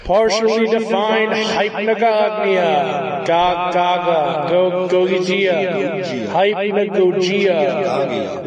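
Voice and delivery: slow dramatic title read; drawn-out announcer voice. Shown as a demonstration. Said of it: "I'm still in shit mode".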